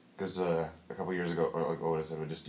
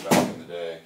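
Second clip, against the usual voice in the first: put-on old-timer; 2 semitones lower, 8 dB louder than usual. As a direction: say it like ages ago. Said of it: "I make it sound like it was so long ago".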